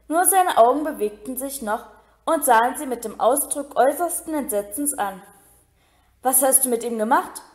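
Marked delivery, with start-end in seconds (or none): none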